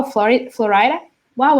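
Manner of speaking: ordinary talk, nothing marked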